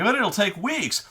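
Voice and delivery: mock whine; whiny DM. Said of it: "he'll be like".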